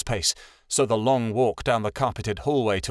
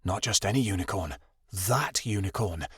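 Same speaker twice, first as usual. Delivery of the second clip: hushed; 4 semitones lower, 5 dB quieter than usual. A narrator's reading. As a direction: murmur it in awe. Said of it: "another hushed".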